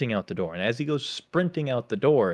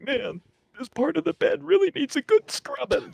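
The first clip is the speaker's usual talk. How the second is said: much higher, exaggerated innocent voice; mock innocent recruit. Recruit faking focus on scrubbing to hide laughter, high innocent voice. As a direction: play the recruit pretending to be busy, squeaky high.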